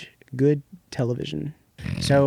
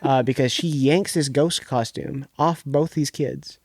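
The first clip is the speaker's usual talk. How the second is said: ordinary delivery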